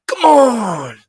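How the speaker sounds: put-on "Come on!" shouted high and loud, Argentine accent, falling off at the end; Fernando's catchphrase. Guide mimicking Fernando in the background of phone clips, loud high cheer. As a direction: cheer like the group's hype man. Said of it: "Like that, exactly verbatim accent and everything".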